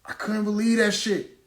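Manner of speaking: high-pitched disbelief; high, aggrieved disbelief; incredulous. Retold or imitated. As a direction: pitch it up, can't believe it happened. Reliving disbelief at being locked up.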